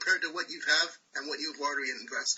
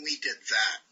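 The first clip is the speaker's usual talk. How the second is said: lower, dismissive; 7 semitones lower than usual. Shown as a demonstration. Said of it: "rather than".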